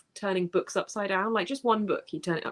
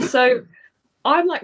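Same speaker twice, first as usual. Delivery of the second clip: laughing; 6 semitones higher, 9 dB louder, slower than usual. Real, not acted.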